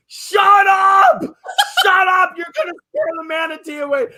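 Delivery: hammed-up exasperated shout; mock yelling. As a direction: yell it, then rush the warning. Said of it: "The captain yells at him and goes".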